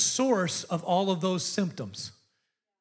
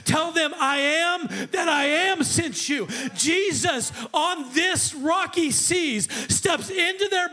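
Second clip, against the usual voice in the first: preaching at full force; 8 semitones higher than usual. Acted. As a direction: proclaim it to the rafters.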